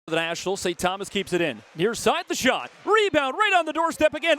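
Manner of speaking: quick call that lifts and shouts on the goal; live play-by-play. Acted. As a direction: call the action as it happens.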